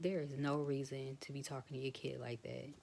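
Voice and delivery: usual speaking voice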